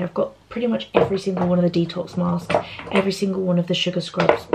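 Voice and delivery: ordinary talk, nothing marked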